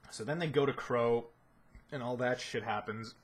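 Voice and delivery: ordinary talk, nothing marked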